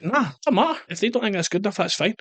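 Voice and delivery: high, breezy dismissal; mock shrug. His own dismissive inner voice.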